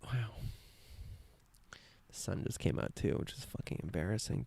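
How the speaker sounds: very soft, awed murmur; quiet, almost to yourself; hushed. Real, not acted. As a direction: murmur it under your breath, overcome. Moved by the beauty around them.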